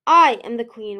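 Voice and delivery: firm, loud assertion, leaning on I. A narrator's reading. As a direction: stake your claim to the throne. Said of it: "Ruby said firmly".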